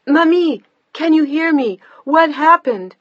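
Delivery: high, loud frantic calling; desperate shout. A narrator's reading. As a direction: shout down a fading line. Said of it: "she shouted louder".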